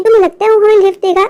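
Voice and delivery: normal talking voice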